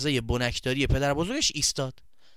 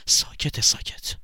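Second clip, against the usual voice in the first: voiceless, secretive whisper; hushed whisper; slower than usual. Acted. Narrator shows the boy working wordlessly, whispering. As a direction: whisper it like a hush.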